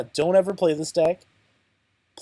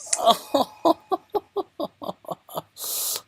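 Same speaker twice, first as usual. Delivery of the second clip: high villain laugh; 13 semitones higher than usual. Acted. Villain laugh after boast.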